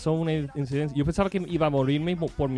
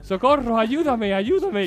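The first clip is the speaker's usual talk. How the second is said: calling socorro, higher and louder, fading off; re-enacting a panicked shout for help; retold cry for help; 5 semitones higher, 4 dB louder, slower than usual. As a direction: relive yelling for help from the river. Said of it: "estoy gritando ahí en el río".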